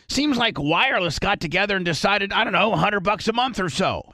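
upbeat sponsor read. Acted. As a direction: sell it like a radio spot. Host-read ad.

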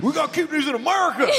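put-on high, quick Southern American accent; hammed-up Southern American protest; mock Southern American. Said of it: "take our Southern roles".

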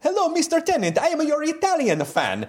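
put-on hammy Italian accent, high and sing-song. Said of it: "I'm gonna do another accent".

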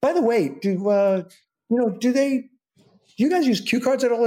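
mock nervous fishing for reassurance; imitating his own anxious self. Nervous question to co-star.

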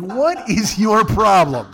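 slow, loud mock outrage; indignant challenge. To sick girlfriend grabbing car keys, indignant. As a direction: stretch each word out in loud disbelief.

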